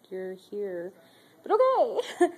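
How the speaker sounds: wry inner thought. Retold or imitated. Inner thought about old resident.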